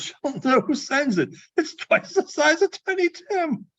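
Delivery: tickled by his own joke; gleeful Scrooge. Acted. Scrooge plotting the turkey gift, high and gleeful.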